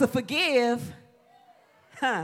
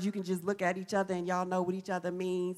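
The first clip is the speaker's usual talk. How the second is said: low, quick and much softer; hushed aside; 7 semitones lower, 10 dB quieter, faster than usual. Real, not acted. Preacher describes close friends' wordless understanding, hushed quick aside. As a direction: drop into a quick, confiding undertone.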